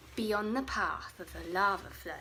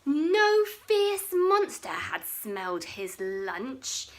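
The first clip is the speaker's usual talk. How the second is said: usual speaking voice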